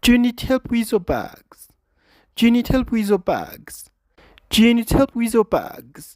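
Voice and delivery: "Do you need help with your bags?" slow and falling; slow drilled question tune; question intonation. Shown as a demonstration. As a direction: ask it slowly with the tune falling at the end. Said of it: "እቲ ኢንቶኔሽን".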